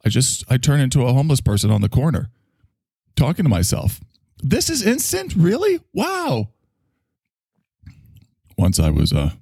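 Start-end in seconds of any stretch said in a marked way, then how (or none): 4.4-6.5 high, overdone disbelief; mock amazement. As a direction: ham up being stunned by instant coffee.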